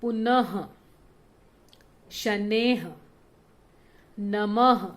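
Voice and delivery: पुनः, शनेह, नमः each ending in a breathy ह; visarga at word end. Shown as a demonstration. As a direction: let a clear ह sound close पुनः शनेह नमः. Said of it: "अंत में ह आता है". (पुनः, शनेह, नमः के अंत में साफ़ ह)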